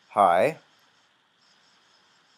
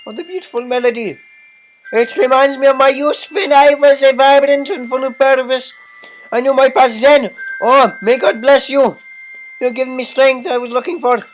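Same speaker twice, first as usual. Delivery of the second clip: funny high voice; 17 dB louder than usual. Acted. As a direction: wail it in a silly falsetto. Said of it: "a funny voice".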